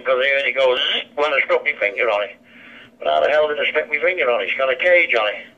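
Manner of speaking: ordinary talk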